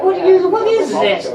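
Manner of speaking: normal talking voice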